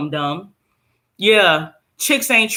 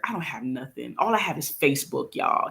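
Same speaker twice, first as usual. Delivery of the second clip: ordinary talk, nothing marked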